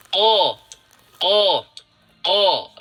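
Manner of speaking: "ko" said with lips pushed round on purpose. Shown as a demonstration. Teacher demonstrating the wrong rounded-lip "ko", loud and deliberate. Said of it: "Contoh seperti ini".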